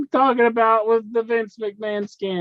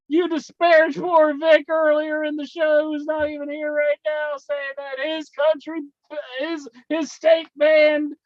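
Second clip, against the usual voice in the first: mock tirade; 7 semitones higher than usual.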